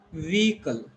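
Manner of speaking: vehicle with long E and silent H, VEE-kul; teacher modelling vehicle with a long "ee"; correct pronunciation. Shown as a demonstration. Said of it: "This is long E".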